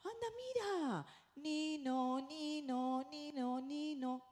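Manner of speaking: "nino, nino" sung high like an ambulance siren; siren imitation for a child. Shown as a demonstration. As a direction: call out excitedly, then copy the siren. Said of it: "yo le voy a decir al niño".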